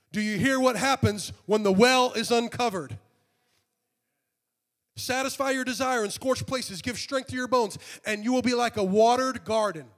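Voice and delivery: high, loud proclaiming of the promise; full-force preaching. Acted. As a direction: hammer the promise home to the church. Repeats passage louder, higher.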